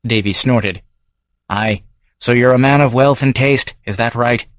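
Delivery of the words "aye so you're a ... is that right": Scots publican's regular, raised and brisk; gruff Edinburgh local sizing up a stranger; Davy's Scots brogue; Scottish barfly. Acted. Davy mocks stranger.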